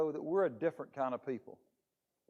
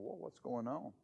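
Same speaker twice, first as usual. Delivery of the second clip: hushed alarm; 3 semitones lower, 10 dB quieter than usual. Acted. Philistines hear the distant noise.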